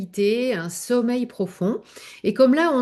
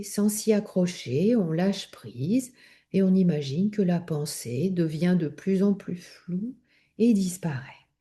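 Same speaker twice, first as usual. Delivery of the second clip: guided meditation; 2 semitones lower than usual. Acted. Yoga teacher leading listeners through breathing and letting go, guided meditation. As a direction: guide them calmly into rest, unhurried. Leads yoga nidra relaxation.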